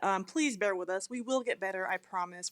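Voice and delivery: usual speaking voice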